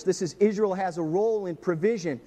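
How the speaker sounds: ordinary delivery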